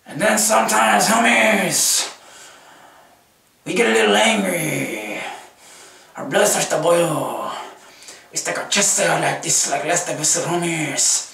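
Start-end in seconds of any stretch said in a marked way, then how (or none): none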